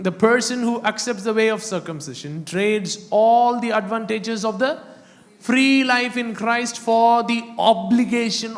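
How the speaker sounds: high-pitched, insistent preacher's reading; emphatic scripture preaching. Acted. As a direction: declaim it as a warning to the congregation.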